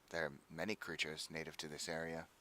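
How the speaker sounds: low and soft; soft giant voice. Acted. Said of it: "I didn't hear any of that".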